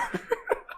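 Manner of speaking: short laugh at the story. Real, not acted. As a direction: burst of amused laughter.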